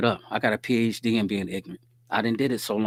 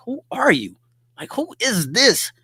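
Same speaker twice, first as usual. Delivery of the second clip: high-pitched, loud mock bewilderment; incredulous; 11 semitones higher, 6 dB louder than usual. Man waking next to a stranger, loudly incredulous. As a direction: squeal it in baffled disbelief, hammed-up.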